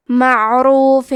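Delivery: معروف with thick ر, slowly; heavy ra. Shown as a demonstration. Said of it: "را کو موٹی آواز میں پڑھنا ہے".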